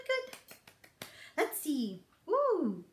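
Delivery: ordinary delivery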